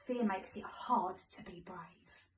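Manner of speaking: plain reading voice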